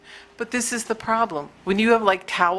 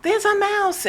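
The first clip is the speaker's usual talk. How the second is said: high-pitched mock yell; retold scream; 12 semitones higher than usual. Wife calling husband about a mouse, mock high scream. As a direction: cry out the alarm in a high voice. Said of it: "I was screaming for my husband".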